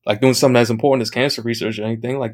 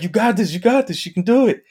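high excited cheer, climbing higher; 8 semitones higher than usual. Retold or imitated. Chat cheering on the solo player, high and excited. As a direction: pump up a struggling friend. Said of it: "there's other people like".

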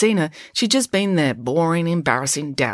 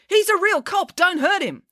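alarmed cry. A narrator's reading. Joe stopping Mum attacking a constable, crying out. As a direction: cry out urgently to stop her. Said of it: "cried Joe".

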